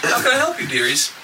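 high crone-like barmaid greeting; sweet old innkeeper voice. Acted. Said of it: "I'm gonna try voice".

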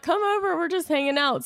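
high, bright call to come over; high come-over invite to a crying friend; eager invite. Retold or imitated. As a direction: pitch it up like waving her in. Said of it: "I'm like".

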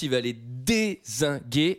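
slow, stretched-out DÉZINGUER; drawn-out emphasis. Real, not acted. Man recapping the gladiator's massacre, slow emphatic delivery. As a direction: draw out each syllable of dézinguer.

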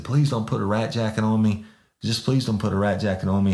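high, gushing apology; groveling apology. Retold or imitated. Suspect apologizing to the sergeant, high and groveling. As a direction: gush sorry like you mean it.

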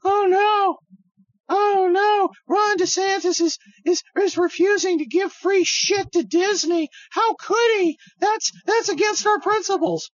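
high, loud mock panic; hammed-up shrill-free squeal of faux outrage; mocking whiny conservatives. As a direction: whine it like a clutching-pearls pundit. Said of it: "And if".

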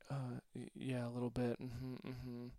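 soft, hesitant imitation of a nervous kid; reluctant admission. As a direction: admit it quietly, as the scared boy. Scared kid reluctantly admits fear.

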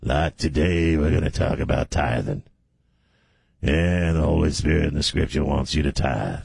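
low sermon voice with weighty pauses; real low preacher opening. Retold or imitated. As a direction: lay out the scripture low and measured. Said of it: "he starts off real low".

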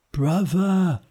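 high, far voice for Pigsy; a high-voiced pig whispering; Pigsy leans in and whispers to Monkey, in a high voice. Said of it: "whispered Pigsy to Monkey".

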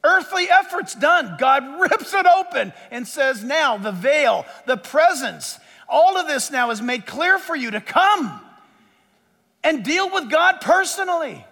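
high, stretched-out proclamation; emphatic pulpit delivery; impassioned preaching. Real, not acted. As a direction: proclaim it slowly with rising force. Preaches at full force.

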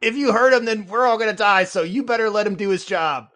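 high, loud scolding yell; scream at him. Acted. Player screaming at the reptilian to spare the pilot, loud yell. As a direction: yell it as an urgent warning. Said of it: "I'm just gonna scream at him".

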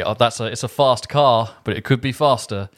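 a lightly put-on English accent; weaker English accent.

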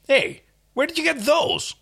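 high indignant protest. Acted. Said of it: "Crichton protested".

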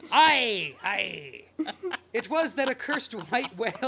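loud, high gruff pirate. Acted. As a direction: growl it like an old sea captain. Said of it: "a little bit of a pirate voice".

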